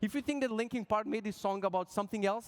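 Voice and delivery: normal talking voice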